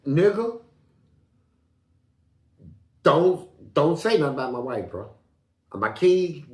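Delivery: loud, heated warning; angry warning. Retold or imitated. Warning the other inmates off his wife, loud and heated. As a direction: bark it at the doubters.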